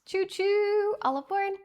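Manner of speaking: high sing-song conductor call; chugging toy train voice. Pretend. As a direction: call all aboard like a cheery conductor. Streamer train hype call.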